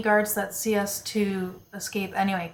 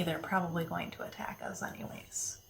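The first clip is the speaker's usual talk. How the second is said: whisper; 12 dB quieter than usual. Real, not acted. Player whispers aside.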